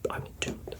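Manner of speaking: breathy whisper of the promise. Shown as a demonstration. Host quietly promising listeners the episode, whispered. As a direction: whisper it like a secret vow.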